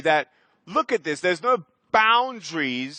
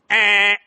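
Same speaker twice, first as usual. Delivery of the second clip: loud dismissive eh; 3 semitones higher, 8 dB louder than usual. Real, not acted. Dismissing the inner voice.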